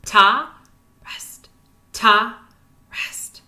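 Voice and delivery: leading the class, ta loud and rest whispered. Shown as a demonstration. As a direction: contrast a voiced ta with a whispered rest. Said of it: "So whisper those rests".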